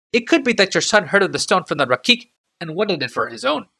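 careful servant choosing his words, pitched high; Hajar's voice. A narrator's reading. Hajar stammers an answer.

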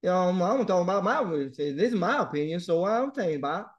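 thick put-on Louisiana accent. Shown as a demonstration. Teacher mocking the idea of one American accent, Louisiana accent. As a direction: imitate a hard-to-follow Louisiana speaker. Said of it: "come from Louisiana and say".

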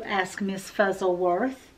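ordinary delivery

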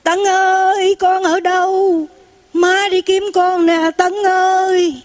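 high, slow, long-drawn wail; plaintive acted cry carried far; heartrending mother's call. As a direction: call out for a lost child, stretching each word. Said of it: "cái tiếng người mẹ kiếm con rất là thảm thiết".

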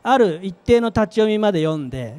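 ordinary talk

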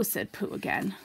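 plain reading voice

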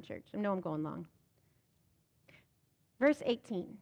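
ordinary talk

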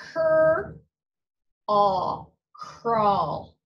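"Cur", "aw", "crawl" split into sounds, then blended; blending sounds. Shown as a demonstration. Teacher blends a word sound by sound for kids. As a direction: sound out each part, then join them into "crawl". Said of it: "sound-by-sound blending".

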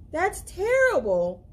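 ordinary delivery, nothing marked

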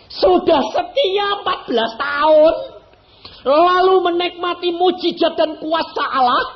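high, loud pulpit build-up; soaring sermon peak; preaching at full force. Acted. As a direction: drive the point home to the congregation.

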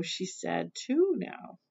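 plain reading voice

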